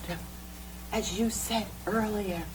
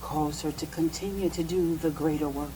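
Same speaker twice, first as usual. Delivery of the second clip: hushed prayer; 3 semitones lower than usual. Real, not acted. Minister praying over his sister, hushed and reverent.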